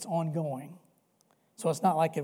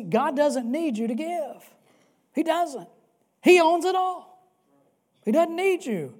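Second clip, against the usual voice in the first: higher, louder, pounding it home; emphatic preaching; 9 semitones higher, 6 dB louder than usual. Real, not acted. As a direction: rise up and declare it. Preacher rises to emphatic peak.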